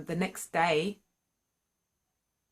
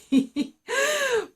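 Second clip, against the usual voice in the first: embarrassed laugh; 8 dB louder than usual. Real, not acted.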